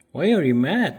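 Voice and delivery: high, loud sing-song coo to a pet; high pet talk. Real, not acted. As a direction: coax the pet with a lifted, loud voice. Cooing at upset pet Peter.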